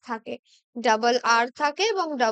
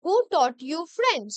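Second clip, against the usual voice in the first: slow dictation; much slower than usual. Shown as a demonstration. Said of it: "Change the voice".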